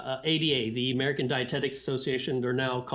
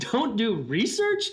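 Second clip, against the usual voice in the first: slow, higher, louder disbelieving question, "research" rising with a laugh; incredulous echo; 7 semitones higher, 3 dB louder, slower than usual. Retold or imitated. Echoing the president's advice back to Jeff, laughing in disbelief. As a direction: stretch "Don't do research?" out slowly, higher and louder, "research" climbing up in disbelief. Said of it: "So that really shocked me".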